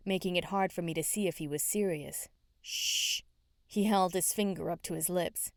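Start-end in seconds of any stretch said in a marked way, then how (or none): 2.6-3.2 whispered shh; shushing. Acted. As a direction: hush him with a breathy shh. Hushing a friend.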